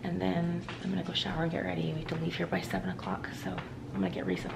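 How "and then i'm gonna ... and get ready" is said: ordinary talk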